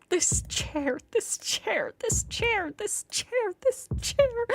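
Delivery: high-pitched, fixated repetition; obsessive rant. A narrator's reading. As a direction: repeat it like you're losing your mind.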